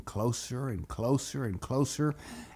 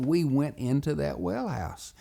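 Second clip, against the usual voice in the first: usual speaking voice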